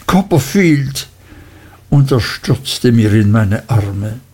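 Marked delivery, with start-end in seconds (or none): 0.1-1.1 raised voice of happy recognition; delighted cry. A narrator's reading. Traddles recognises his old friend, delighted cry. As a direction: call the name out, pitched high. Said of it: "rief Traddles, als er aufblickte".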